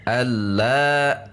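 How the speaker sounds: "alla" with the final la pressed and drawn out; menekan pada la. Shown as a demonstration. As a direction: lean on the la of "alla", stretching it slowly. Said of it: "kita akan menekan pada huruf la". ("alla" dengan la ditekan hingga panjang)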